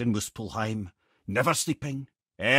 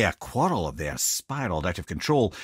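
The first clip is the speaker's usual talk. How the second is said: ordinary talk, nothing marked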